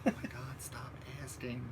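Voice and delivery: hushed, breath-only whisper; whispered aside. Real, not acted. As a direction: whisper it very softly, under your breath. Hushed aside about viewers' requests.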